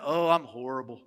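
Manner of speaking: a touch of mock misery; honest reply. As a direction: admit you're not fine. Imagined honest answer.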